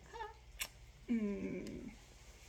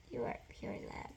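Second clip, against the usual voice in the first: said softer the second time; soft repeat; 8 dB quieter than usual. Shown as a demonstration. Repeating a blessing to the viewer, softer. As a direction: hush it down, tender.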